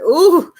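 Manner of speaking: high, loud shuddering ooh; squeamish ooh. Real, not acted. Woman recalling nails on a chalkboard, high squeamish ooh. Skin crawls at handshakes.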